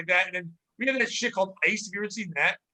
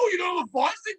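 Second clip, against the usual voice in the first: mock disbelief; 10 semitones higher than usual. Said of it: "I go".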